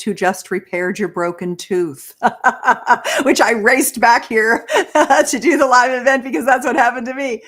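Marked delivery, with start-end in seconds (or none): 2.2-4.7 words bubbling with real laughter; laughing while talking. Real, not acted. Telling the host she raced back, laughing. Laughing over her own rush. 4.7-5.2 a quick burst of real laughter; short bright laugh. Real, not acted. Sharing her rushed day with the host, laughing. 5.3-7.4 laughing and hurrying the words, a bit louder; laughing, rushed. Real, not acted. Explaining to the host why she rushed, laughing fast.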